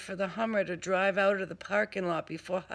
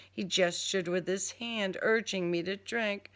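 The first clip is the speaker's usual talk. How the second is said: ordinary delivery, nothing marked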